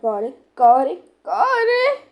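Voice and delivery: ordinary talk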